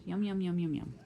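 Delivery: plain reading voice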